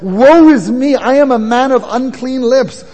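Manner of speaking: Woe is me cried out loud, the word am leaned on; loud anguished cry. Acted. Preacher voicing Isaiah's cry of woe, loud and pained. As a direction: let Isaiah's lament ring out. Prophet's cry voiced in sermon.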